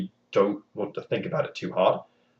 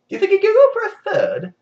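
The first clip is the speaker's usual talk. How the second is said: much higher, louder greedy wheedle; higher greedy king voice; eager king; 8 dB louder than usual. Acted. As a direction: beg for more gold. King asking again.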